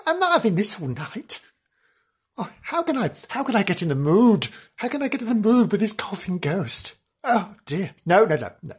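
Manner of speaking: high, fluttery excitable woman voice; Janice character. Acted. Janice reporting from the haunted hotel, high and fluttery. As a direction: play a giddy guest in a haunted room.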